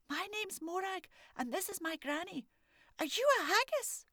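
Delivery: put-on high, bright child's voice; a curious little girl asking boldly; little girl Morag. Morag asking the old man if he's a haggis, high child voice.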